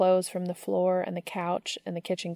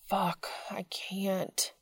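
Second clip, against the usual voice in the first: slow, whispered refusal; anguished whisper; 7 dB quieter, much slower than usual. A narrator's reading. Dean groans, refusing the invitation.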